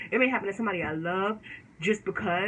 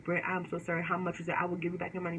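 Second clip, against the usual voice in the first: apologetic; 3 semitones lower, 6 dB quieter than usual. Retold or imitated. Nail tech apologizing to client.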